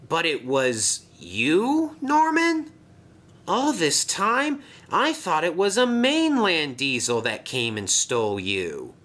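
an engine realising the truth, voice lifted; voiced engine.

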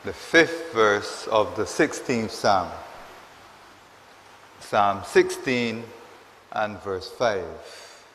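loud, measured proclamation of a psalm; solemn scripture reading. Real, not acted. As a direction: read out the verse deliberately, with long pauses. Announces and reads the verse.

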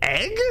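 high, questioning "egg" with short e; rising short-e "egg"; short-e pronunciation. Shown as a demonstration. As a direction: test "egg" as in "edge", doubtfully. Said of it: "that sounds like I'm about to throw up".

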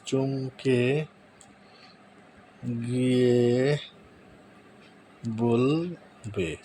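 very drawn-out, word by word; slow dictation. Real, not acted. Tutorial host typing a prompt for viewers, slow dictation. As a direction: stretch each word while typing it.

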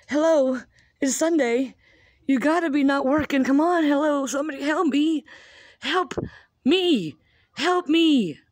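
high, loud pleading calls; calling for help. Retold or imitated. Mom calling her brother-in-law for help, loud high pleading. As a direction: beg loudly for someone to answer.

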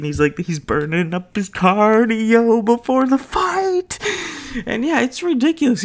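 squealing through giggles, way up high; can barely get the words out for laughing. Real, not acted. Telling her sister's story, cracking up in a high squeal. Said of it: "me and my sister are howling at this".

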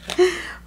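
short, high teary laugh. Real, not acted. Reader laughing as she gets emotional with viewers. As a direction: laugh through rising tears. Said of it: "'Cause I'm starting to get emotional".

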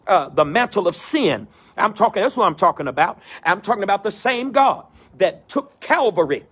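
rising preacher cadence, building phrase by phrase; preaching. Acted. Pastor preaching to his virtual church, in a rolling preacher cadence. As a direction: preach it in a rolling call and response rhythm.

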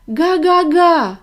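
high, loud, slow honk after it; a gosling shouting goodbye; shouted honk. Acted. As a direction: call after the car. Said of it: "крикнув йому навздогін".

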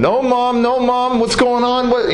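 put-on pleading child's cry, pitched very high; high, sustained retold wail; mock screaming. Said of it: "You know, I'm screaming".

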